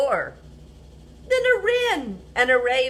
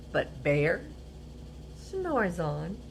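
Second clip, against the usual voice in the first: hushed refrain; 4 semitones lower, 6 dB quieter than usual. A narrator's reading. Refrain read softly.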